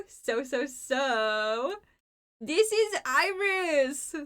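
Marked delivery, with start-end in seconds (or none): 2.4-4.1 drawn-out, much higher intro; high slow introduction. Real, not acted. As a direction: present her sweetly and slowly. Presenting friend, high and slow.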